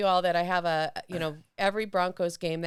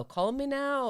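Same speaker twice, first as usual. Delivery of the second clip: Miss Cleo impression; 7 semitones higher, slower than usual. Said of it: "I wanted to do Cleo".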